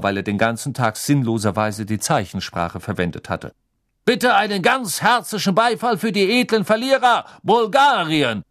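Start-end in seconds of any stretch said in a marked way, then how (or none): 4.0-8.4 high, loud crowd-rousing call; announcer calling out. Acted. Bagman calling on the stadium to applaud Bulgaria, loud high announcer call. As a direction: whip up applause for the losers. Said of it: "rief Bagman".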